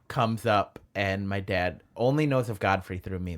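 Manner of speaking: usual speaking voice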